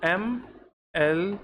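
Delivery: एमएलए said slowly as 'em-el-ay'; एमएलए stretched slowly as three letters; letters spelled out. Shown as a demonstration. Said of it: "एमएलए कहते हो".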